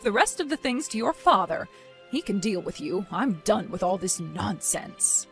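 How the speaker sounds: low, soft, fed-up finality; weary dismissal. Acted. Mother hands Aisha over to father, weary dismissal.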